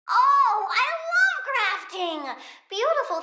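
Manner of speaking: high, slow delighted gush. Acted. Plant girl gushes about grafting, slow delighted. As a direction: linger on love, enchanted.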